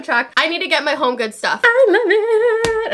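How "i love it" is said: high, long-drawn squeal; real excitement. Real, not acted. Thrilled with her purchases, excited squeal. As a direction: stretch it out high with delight. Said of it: "I'm so excited to use it".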